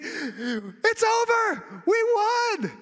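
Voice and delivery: very high, drawn-out triumphant cry; jubilant announcement. Retold or imitated. Runner sprinting home announces the win, high and jubilant. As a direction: stretch the news out like you can't contain it.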